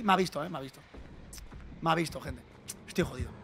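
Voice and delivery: low, hushed resignation; soft, low worry at being spotted; dejected. Real, not acted. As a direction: say it under your breath, sunk and defeated. Spotted, dejected over the game.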